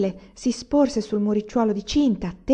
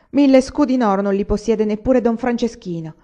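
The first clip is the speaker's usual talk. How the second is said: raised, louder insistence; indignant cry; 4 semitones higher, 7 dB louder than usual. A narrator's reading. Old servant defending her mistress's fortune, indignant cry. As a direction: insist loudly, voice lifted in protest. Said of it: "gridò zia Sorichedda".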